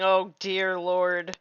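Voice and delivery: ordinary talk, nothing marked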